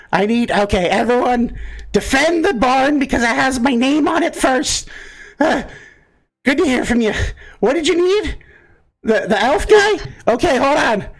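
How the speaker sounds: very high, strained put-on voice; distracted high-pitched character mid-battle; answering prayer voice. Game master as prayed-to voice answering the player, high-pitched. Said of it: "You hear".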